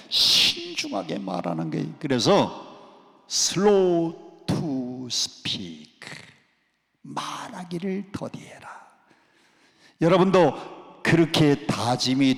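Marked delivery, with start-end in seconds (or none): 3.3-6.0 drawn-out English phrase, word by word; very slow. Shown as a demonstration. Pastor to congregation, slowly drawing out the words. 7.0-8.7 soft breathy whisper of the verse. Shown as a demonstration. Pastor to congregation, whispering the verse.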